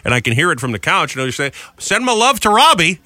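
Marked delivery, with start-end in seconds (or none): 1.8-3.0 mother-in-law in a put-on Southern accent, high and slow; mock Southern accent. Husband imitating mother-in-law on the phone in a Southern accent. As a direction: drawl it like a sweet Southern lady. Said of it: "Why do you always give her, like, a Southern accent?".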